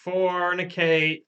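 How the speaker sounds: high, slow prophetic proclamation; mock oracle. Spirit foretelling a listener's doom, mock-oracle intoning. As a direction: intone it like a hammed-up séance spirit.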